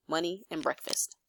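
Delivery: usual speaking voice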